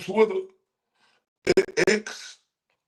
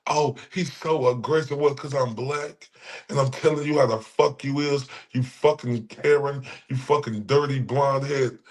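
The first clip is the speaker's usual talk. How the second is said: normal talking voice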